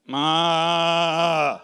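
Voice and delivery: loud, long bellowing moo; preacher mooing for Leah's name; cow moo. Pretend. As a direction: moo like a cow across the room.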